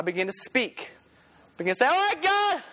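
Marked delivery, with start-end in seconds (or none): 1.9-2.6 forced willingness. Acted. Makes himself begin to praise.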